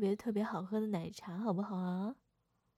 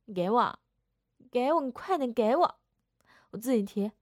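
insistent demand; 10 dB louder than usual. Acted. Sister demanding the bag back.

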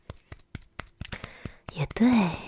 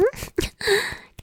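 high teasing laugh; 13 semitones higher than usual. Acted. Teasing partner.

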